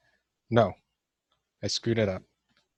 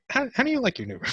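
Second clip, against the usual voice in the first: much higher, sing-song mom voice; mock mom voice; faster than usual. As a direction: ham up a sweet mom greeting. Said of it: "It's not as funny as I was hoping".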